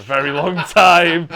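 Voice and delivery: mock-furious shout, all in fun; mock threat yelled. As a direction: bellow a joking threat at your friend. Joking threat over a nickname.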